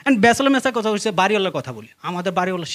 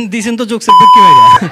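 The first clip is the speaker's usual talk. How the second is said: loud shouted heckle; crowd shout; 11 dB louder than usual. Real, not acted. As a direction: yell from the audience.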